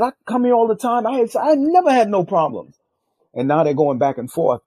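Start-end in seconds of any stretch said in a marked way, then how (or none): 0.0-2.8 high-pitched aggrieved protest; heated retold complaint; getting mad. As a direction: argue your case indignantly, pitched up. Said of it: "I start to get mad".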